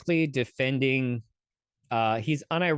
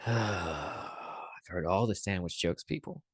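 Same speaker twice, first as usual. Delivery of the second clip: slow, lower, softer and fed up; weary sigh; 7 semitones lower, 9 dB quieter, slower than usual. Real, not acted. Streamer complaining to chat about jokes on her name, sighing and weary. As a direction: sigh it out like you've heard it all.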